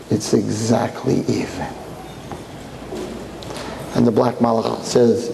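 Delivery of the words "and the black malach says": usual speaking voice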